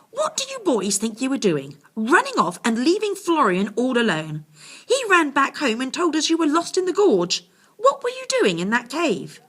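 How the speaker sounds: high, loud telling-off; scolding mother. A narrator's reading. Werner's mum scolds the boys, high and loud. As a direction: give the boys a sharp scolding.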